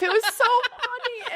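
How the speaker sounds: high, laughing through the words; can't stop giggling. Real, not acted. Telling the host about the vendor, laughing.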